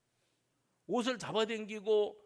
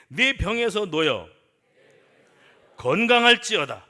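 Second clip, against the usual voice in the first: forceful declaration; 2 semitones higher, 13 dB louder than usual. Shown as a demonstration. Preacher leading the congregation to repeat, forceful proclamation. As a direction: belt it out for the congregation. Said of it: "그러니까 따라하세요".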